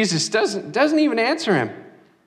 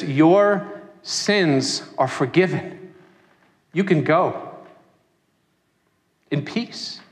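tender forgiveness; 4 semitones lower than usual. Acted. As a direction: lower gentle reassurance. Jesus forgives the woman gently.

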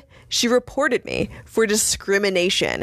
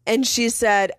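ordinary delivery

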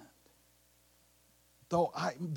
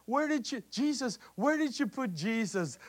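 mock frantic plea; 6 semitones higher, 5 dB louder than usual. Mary pleading, repeated.

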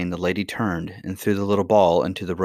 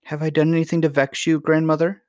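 put-on high, pleading little-girl voice; pitiful; 9 semitones higher, 4 dB louder than usual. A narrator's reading. Princess asking whether she vexed grandmother, pitiful. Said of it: "said Irene pitifully".

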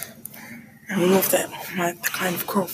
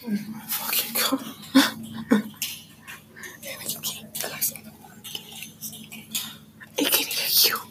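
frantic hushed whisper; 2 semitones higher, 7 dB quieter than usual. Real, not acted. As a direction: hiss it so nobody hears. Scolding whisper in the hallway.

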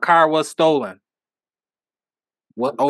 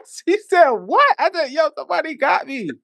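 pitched-up disbelieving back and forth; excited; 11 semitones higher than usual. Retold or imitated. Retold exchange, high.

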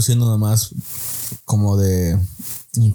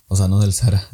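ordinary talk, nothing marked

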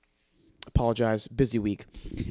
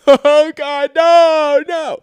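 loud, high, drawn-out mock wail; hammed-up bawling; crying child; 14 semitones higher, 11 dB louder, much slower than usual. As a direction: imitate a kid bursting into tears. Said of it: "he just immediately starts crying".

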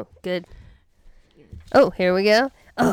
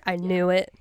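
usual speaking voice

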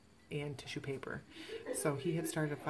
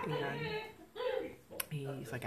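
usual speaking voice

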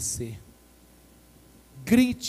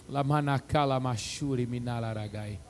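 speaking in tongues; 5 semitones lower, 6 dB quieter than usual. Real, not acted. Fala em línguas, baixo.

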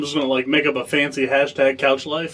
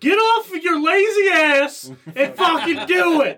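higher, slow, laughing mock yell; 11 semitones higher, slower than usual. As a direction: bark it out while laughing. Yelling motivation at a friend.

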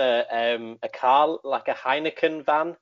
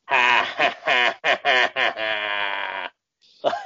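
staccato villain cackle; hammy evil ha-ha-ha; maniacal laugh; much slower than usual. Retold or imitated. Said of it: "he's just like a".

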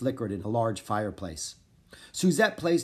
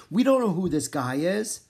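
panicked; 6 semitones higher, 5 dB louder than usual. A narrator's reading. Jack panicking in his head.

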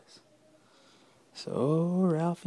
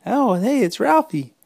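put-on higher, louder kid voice; kids' voices; 10 semitones higher, 7 dB louder than usual. Classmates spot Ralphie, high excited kids. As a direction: call out like excited children.

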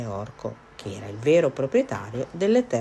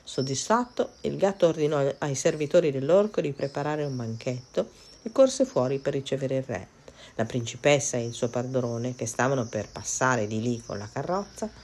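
usual speaking voice

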